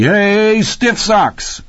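gleeful calling out; exultant yell. A narrator's reading. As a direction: holler the nickname high and jubilant. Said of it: "Rocky yelled exultantly".

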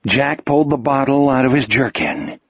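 ordinary delivery

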